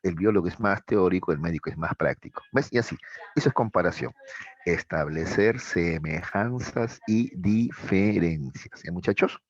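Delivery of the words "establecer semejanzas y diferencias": "Establecer semejanzas y diferencias" spelled out slowly, word by word; slow down and lay out each word of "Establecer semejanzas y diferencias" for students to copy; slow, deliberate definition. Shown as a demonstration. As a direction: dictation pace, every word of "Establecer semejanzas y diferencias" separated. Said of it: "slow repeat as a definition".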